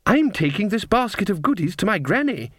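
put-on high, lilting child's voice; little girl. A narrator's reading. Little girl explaining her errand to witches, childlike. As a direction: recite it like a small child. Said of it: "said the girl".

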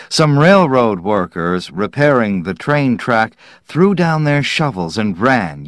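plain reading voice

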